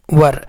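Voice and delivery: वर as one syllable, neutral vowel into r; correct "were" pronunciation. Shown as a demonstration. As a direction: say वर like "wur", no ee or ay. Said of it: "तो इसको क्या पढ़ेंगे".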